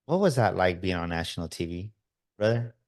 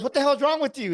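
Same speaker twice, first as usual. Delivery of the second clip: shocked outburst; 4 dB louder than usual. Retold or imitated. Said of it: "The guy goes".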